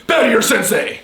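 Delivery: sharp martial arts commands. Acted. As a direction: bark it like a drill sensei. Sensei repeats command louder.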